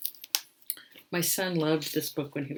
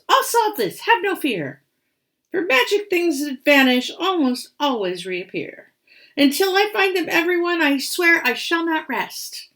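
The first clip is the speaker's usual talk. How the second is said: put-on high, bright-hearted boy hero; eager young Bertie; 11 semitones higher, 9 dB louder than usual. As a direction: reassure grandpa with eager pluck. Bertie vows to find props.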